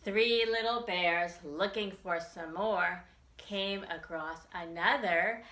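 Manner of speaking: bouncy nursery rhyme read aloud, drawn out before each number; singsong rhyme. Acted. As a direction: chant it for little kids.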